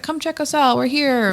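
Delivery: bright, high promo pitch; upbeat ad voice. Retold or imitated. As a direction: sell it like a cheerful flyer. Upbeat postcard ad to neighbors.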